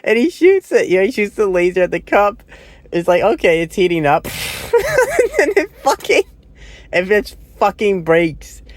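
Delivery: can barely get it out for laughing; laughing while talking. Real, not acted. Reviewer cracking up at the laser scene, laughing through words.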